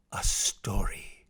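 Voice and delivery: slow, low near-whisper; hushed narration. A narrator's reading. Narrator introducing Papa's bedtime story, hushed and slow. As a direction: drop to a slow, soft hush.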